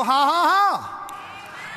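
forceful pulpit laughter; triumphant laugh. Shown as a demonstration. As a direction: laugh boldly in defiance. Triumphant laugh capping the sermon point.

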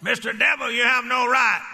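mock shout at the devil; yelling rebuke. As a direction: bark the rebuke out boldly. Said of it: "sit in his car and yell".

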